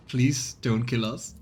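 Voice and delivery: usual speaking voice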